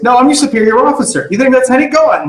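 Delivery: high, indignant officer; superior officer scolding. Acted. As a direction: pull rank in a high, heated voice. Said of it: "the guy goes".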